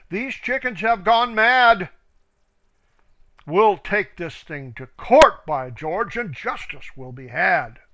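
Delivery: rally the flock for court. A narrator's reading. Wise old duck outraged.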